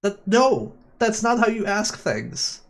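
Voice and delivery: high indignant mock retort; retorting self. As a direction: snap back in a squeaky put-on voice. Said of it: "I'm like".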